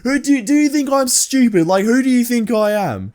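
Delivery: high-pitched mock indignation, slowing at the end; indignant Karen. Retold Karen's indignant outburst.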